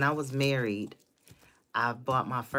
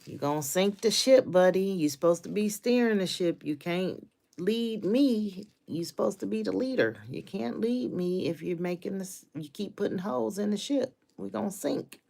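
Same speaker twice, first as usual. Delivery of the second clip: higher and louder, scolding him hard; 8 semitones higher, 7 dB louder than usual. Retold or imitated. Wife scolding her husband for sinking the marriage, loud and high. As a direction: lay into him like he's wrecking everything. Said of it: "I had to be like".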